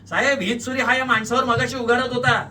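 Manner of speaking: high, eager witness outburst; loud accusation. A narrator's reading. Witness to policeman about the knife, accusing loudly. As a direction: denounce him to the officer.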